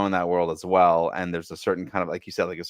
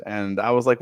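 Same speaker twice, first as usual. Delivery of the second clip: ordinary delivery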